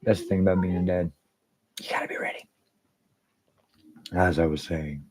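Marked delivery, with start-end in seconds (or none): 1.8-2.5 breathy whisper under his breath; whispered aside. Real, not acted. Streamer whispering a dad joke aside. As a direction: say it as a hushed whisper.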